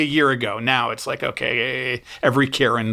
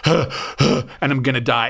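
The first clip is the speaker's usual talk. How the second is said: mock out of breath; slower than usual. Retelling being winded on stairs.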